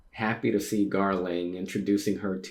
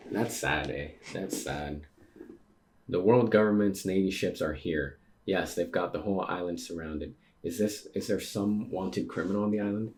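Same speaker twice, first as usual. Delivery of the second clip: usual speaking voice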